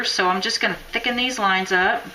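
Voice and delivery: normal talking voice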